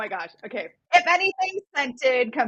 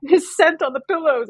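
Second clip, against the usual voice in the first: mocking sneer; 8 semitones higher, 5 dB louder than usual. Mocking a romance trope.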